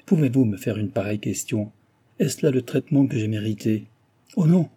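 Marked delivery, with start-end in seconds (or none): 4.4-4.7 high, loud burst of protest; lively outcry. A narrator's reading. Ruined husband cries out to Cécile, high and loud. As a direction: cry it out with sudden vivacity. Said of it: "s'écria-t-il avec vivacité".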